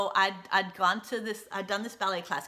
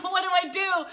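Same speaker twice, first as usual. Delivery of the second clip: panicked; 10 semitones higher, 4 dB louder than usual. Retold or imitated. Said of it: "And I'm shocked".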